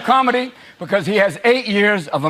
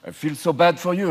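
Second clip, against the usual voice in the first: mock French pity; 4 semitones lower, 4 dB quieter than usual. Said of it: "the French were going".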